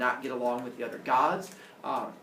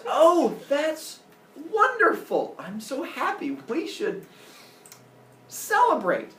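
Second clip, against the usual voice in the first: very high, loud put-on joy; fake delight; 13 semitones higher, 6 dB louder, slower than usual. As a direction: gush with obviously phony happiness. Zeus feigns joy to trick her.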